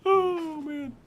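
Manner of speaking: high sigh after a big laugh; worn out from laughing at your own bit. Real, not acted.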